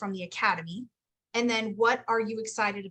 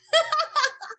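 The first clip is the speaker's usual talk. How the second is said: a high, loud burst of laughter; 6 dB louder than usual. Real, not acted. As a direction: laugh at your own teasing. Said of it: "I'm making fun of you".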